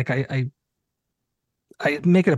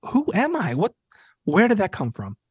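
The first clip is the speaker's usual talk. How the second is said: higher, incredulous self-questioning; retold bewilderment; 6 semitones higher than usual. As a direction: ask yourself in disbelief. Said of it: "I was just like".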